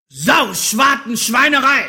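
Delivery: loud, high-pitched angry curse; furious shout. Acted. Wizard swearing in his magic kitchen, furious shout. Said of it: "schrie er".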